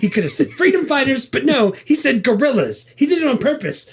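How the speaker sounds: high, fast, laughing disbelief. Real, not acted. Friend joking about the gorilla pun, laughing high. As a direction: chuckle through the joke about guerrillas.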